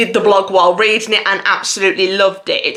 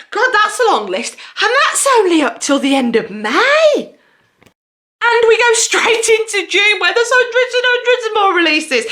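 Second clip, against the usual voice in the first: higher, laughing and overwhelmed; a giddy high rush of disbelief; 11 semitones higher than usual. Real, not acted. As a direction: gush at how much is coming. Overwhelmed by the list.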